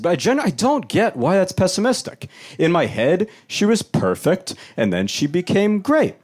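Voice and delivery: ordinary delivery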